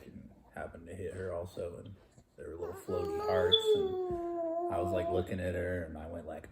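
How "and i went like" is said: normal talking voice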